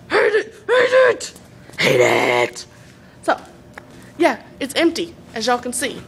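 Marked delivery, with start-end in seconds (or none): 0.1-1.3 high, squealed mock whine; squeaky, slowed-down fake tantrum. Mock tantrum over own accent. 1.8-2.7 suddenly deep mock-angry voice; deep, emphatic fake disgust; low mock growl. Same words dropped low after squeal.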